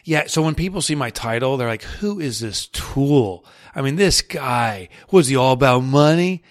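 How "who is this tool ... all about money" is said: put-on loud New York accent, drawn-out opening words. Guest imitates people judging his book title, New York accent. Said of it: "that New York accent comes in".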